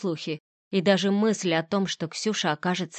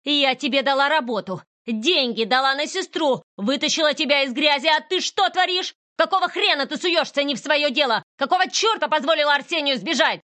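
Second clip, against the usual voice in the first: higher, scolding rant at full steam; angry tirade; 7 semitones higher, 3 dB louder than usual. Acted. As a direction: berate her for ruining everything. Boss berates the heroine. (гневная тирада хозяйки)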